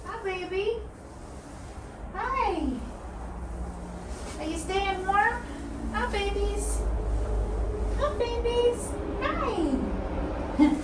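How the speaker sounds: high, soft pet talk; baby talk. Acted. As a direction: coo at the kitties. Greeting the cats fondly.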